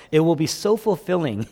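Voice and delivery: ordinary delivery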